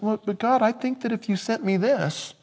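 high pleading bargaining voice; mock a believer bargaining with God; whiny petitioner. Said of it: "It's like".

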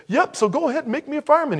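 high, hurried cartoon voice; eager cartoon turtle. Voicing eager cartoon turtle to wizard.

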